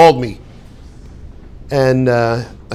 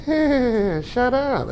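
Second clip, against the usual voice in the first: higher, softer, with a weird grin; creepy smiling; 6 semitones higher, 9 dB quieter than usual. Shown as a demonstration. Teacher redoes the smiling shut up, higher and softer. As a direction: say it through an unsettling smile. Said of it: "it's like a weird smile".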